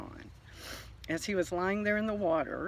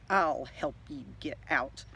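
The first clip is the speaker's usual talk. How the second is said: snarling; 3 semitones lower than usual. Acted. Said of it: "Missus Redbird snarled".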